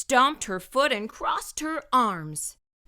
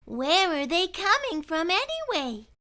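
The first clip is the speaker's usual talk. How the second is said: put-on high girl's voice, idly wondering; lightly voiced absent girl; absent-minded; 8 semitones higher than usual. A narrator's reading. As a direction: ask it half-distracted. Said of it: "Mona wondered absently".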